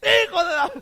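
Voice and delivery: full-force high imitated yell; megaphone-loud shout. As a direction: bellow the name as if amplified. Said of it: "le subieron al megáfono al tipo".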